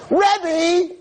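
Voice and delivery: high, loud shout across the hill; girl calling out. Retold or imitated. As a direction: call out to get his attention from behind. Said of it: "someone screams".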